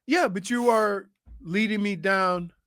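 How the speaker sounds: usual speaking voice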